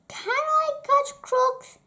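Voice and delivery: very high excited self-question. Acted. Alfie asks himself about the detective job, excited. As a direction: ask yourself in delight. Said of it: "he asked himself".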